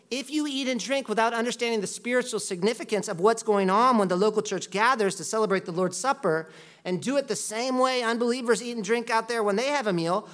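normal talking voice

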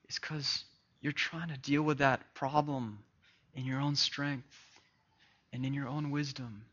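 soft, intimate preaching; quiet pastoral appeal. Acted. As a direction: speak to each heart gently.

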